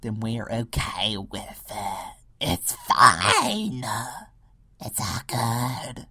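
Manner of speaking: a hint of a gullible voice, slow, with a loud it's fine; easy-going mock agreement, drawn out; mock sheeple. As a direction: play a lightly voiced dupe. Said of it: "People are like".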